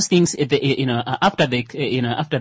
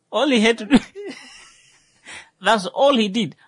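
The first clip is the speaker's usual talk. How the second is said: laughing; 10 semitones higher than usual. Real, not acted. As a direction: giggle through it. Laughing about [name]'s part.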